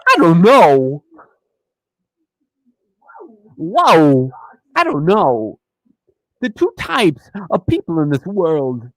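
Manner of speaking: halting celebrity impression with long pauses; Christopher Walken. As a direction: break the sentence up oddly, like a bad impressionist. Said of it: "That's a horrible Christopher Walken impression".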